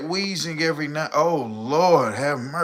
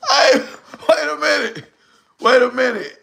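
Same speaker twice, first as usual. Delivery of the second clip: normal talking voice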